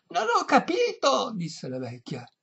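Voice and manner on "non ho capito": put-on high, loud old-lady voice; deaf old lady. A narrator's reading. Deaf old lady to violinist, high loud voice.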